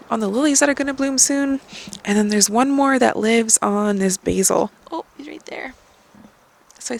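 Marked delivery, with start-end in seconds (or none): 4.9-5.7 high, soft delighted coo. Real, not acted. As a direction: coo gently at the little bug. Spotting caterpillar on basil.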